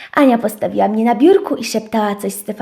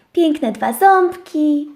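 plain reading voice

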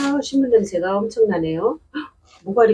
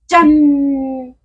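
ta-da reveal; 6 semitones higher, 5 dB louder, much slower than usual. Real, not acted. Reveals plant to viewers.

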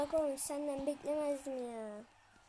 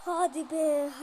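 frustrated; 2 semitones higher, 8 dB louder, slower than usual. Real, not acted. Frustrated cry.

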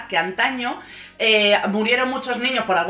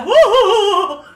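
high, loud hammed-up laughing hoots; mock the mother's over-the-top laughter; exaggerated cackling laugh; 9 semitones higher, 8 dB louder, slower than usual. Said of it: "Súper, además, estrafalaria, exagerando". (imita la risa exagerada y estrafalaria de la madre)

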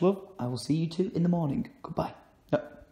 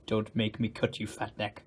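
lower, softer mock threat from the cat; 7 semitones lower, 8 dB quieter than usual. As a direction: growl it as the cat.

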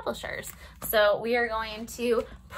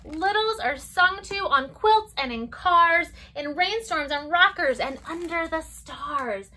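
lilting storybook read-aloud; circle-time story voice; reading to children; 2 semitones higher than usual. Acted. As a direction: read it gently to toddlers. Reads picture book to children.

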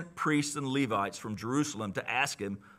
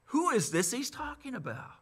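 amazed crowd; 5 semitones higher, 4 dB quieter than usual. Acted. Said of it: "and it's like".

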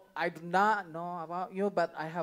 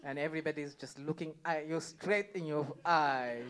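normal talking voice

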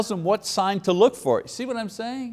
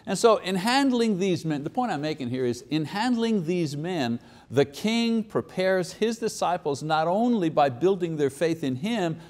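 ordinary delivery

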